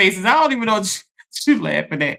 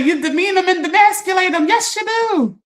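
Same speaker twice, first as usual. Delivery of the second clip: pitched way up, imitating Iyanla's preaching; Iyanla quote; 11 semitones higher than usual. Quoting Iyanla to mom's friends, much higher. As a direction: channel Iyanla scolding a couple. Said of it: "That's a quote from Iyanla".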